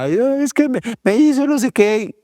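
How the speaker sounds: put-on high, complaining kid voice; whiny child drama. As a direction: act out a son throwing a tantrum story. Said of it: "me tira un drama".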